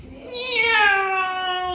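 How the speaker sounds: high, loud drawn-out meow; cat meow. Acted. Baby Bear in bed playing, long loud meow. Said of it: "went Baby Bear".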